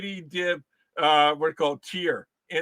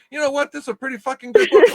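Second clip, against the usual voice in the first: mock enthusiastic review; 7 semitones higher, 9 dB louder, faster than usual. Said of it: "Read the book and say".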